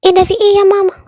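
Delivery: jolted awake and confused; startled. Acted. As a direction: daughter woken suddenly asks mother what's happening. Daughter woken suddenly.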